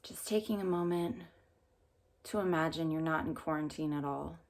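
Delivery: calm meditation guide with long pauses; unhurried phrases, leaving room to breathe between them; guided meditation. Real, not acted.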